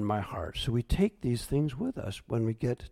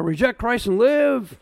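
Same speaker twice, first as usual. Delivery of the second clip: mock threat; 8 semitones higher, 6 dB louder than usual. Threat quoted at pastors.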